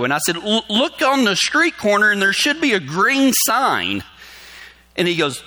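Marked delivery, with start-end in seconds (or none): 0.4-4.0 higher, slow, emphatic directions; retold urgent guiding over the phone; insistent instructing. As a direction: spell it out slowly for someone confused.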